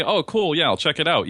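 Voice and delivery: high, breezy fake-polite cool; polite brush-off. Shown as a demonstration. Podcaster showing how to politely brush off a fan, high and breezy. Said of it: "you just say".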